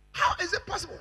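high-pitched, falling sharply at the end; high rhetorical challenge to the congregation; incredulous question. Real, not acted. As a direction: ask it high, in disbelief.

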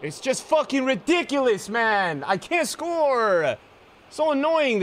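high, loud exasperated rant; slow, strained complaint about missing; frustrated outburst. Real, not acted. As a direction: vent the frustration loudly. Frustrated at missed chances.

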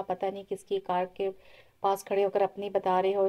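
ordinary delivery

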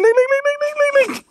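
very high rapid look look; excited squeal. Real, not acted. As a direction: squeal at the animal.

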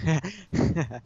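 real burst of laughter; laughing. Real, not acted. Friend hears the player was terrified, bursts out laughing. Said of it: "I'm laughing now".

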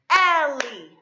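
Ellie chanted loud in two drawn-out beats; syllable by syllable. Shown as a demonstration. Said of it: "Let's clap 'em out".

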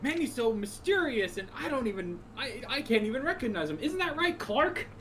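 high-pitched, hammed-up naive wondering; mock cluelessness. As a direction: play oblivious in a high, exaggerated voice. Mock-clueless friend of Clark Kent.

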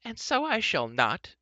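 high, loud, slow refusal; indignant, drawn-out retort; defiant cry. A narrator's reading. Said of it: "cried Eilonwy".

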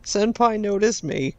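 high, slow fangirl parody; mock adoring admirer. Player mocking the Baron's favorite, smitten.

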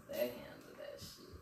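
whispered aside. Real, not acted. Joking aside about Italians.

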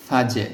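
ফাজে with a bouncing, echoing জ; qalqala. Shown as a demonstration. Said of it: "এখানে কলকলা হবে ফাজে". (কলকলা দিয়ে ফাজে)

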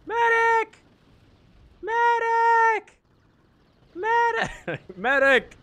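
high wailing calls for a medic; man down shout. Pretend. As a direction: cry out for the medic like a wounded soldier. Downed, calling for revive.

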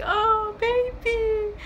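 very high, loud, drawn-out coo; retold squeal of delight, way up high; touched squeal. Reliving her reaction to his proposal, touched squeal.

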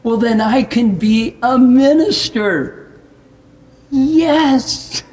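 high, loud breakthrough of joy; jubilant realisation. Retold or imitated. Speaker realises he can be a minister, jubilant cry. Acts out his joyful realisation.

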